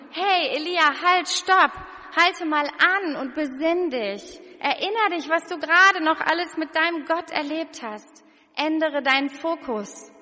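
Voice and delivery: loud, high urgent call to stop him; calling out to Elia. Pretend. Preacher calling out to the fleeing Elia, loud and high. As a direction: shout after him as he runs off. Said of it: "Vielleicht willst du Elia auch zurufen".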